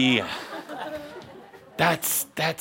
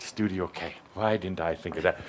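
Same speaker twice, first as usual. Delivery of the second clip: usual speaking voice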